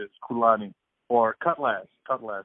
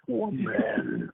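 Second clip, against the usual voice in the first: movie guy voice; 8 semitones higher than usual. Shown as a demonstration. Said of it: "it just sounds like this".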